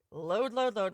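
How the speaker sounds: ordinary delivery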